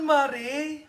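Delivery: ordinary talk, nothing marked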